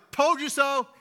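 high, loud singsong told you so; mocking gloat. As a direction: rub it in like a smug parent. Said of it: "I wasn't standing back on the porch like".

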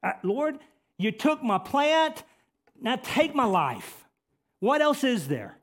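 loud, high cry to God; high-pitched, forceful outcry to the Lord; Jonah crying out. Acted. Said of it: "Jonah cries out here".

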